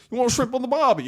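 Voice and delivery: high, hammed-up Australian accent; over-the-top Australian tourist-ad accent; bad Australian accent. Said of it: "I can't even do an Australian accent".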